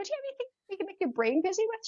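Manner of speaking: playful grandma pitching a distraction; eager suggestion. Retold or imitated. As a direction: excite her into a new idea. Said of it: "I go".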